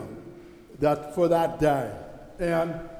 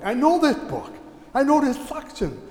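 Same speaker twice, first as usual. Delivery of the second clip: eager, higher-pitched reaction; retold excited recognition; 8 semitones higher than usual. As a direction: act out delight at knowing it. Retelling excited recognition at mass.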